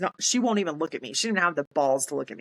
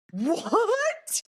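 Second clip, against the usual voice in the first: very high, drawn-out rising question; escalating disbelief. Retold or imitated. Sergeant reacting to the new JAG news, more incredulous. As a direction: let the pitch soar in drawn-out shock. Second, higher shocked reaction.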